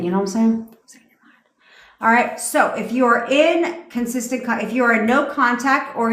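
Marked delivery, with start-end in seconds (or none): 0.9-1.4 whispered under the breath, no voice. Shown as a demonstration. Telling listeners to say it silently, whispered. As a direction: breathe it out silently as if only in your head. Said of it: "say it in your fucking mind".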